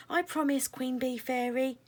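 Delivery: plain reading voice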